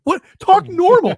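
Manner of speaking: dad telling son to talk normal, high mock exasperation; mock exasperated. Dad reacting to voice crack.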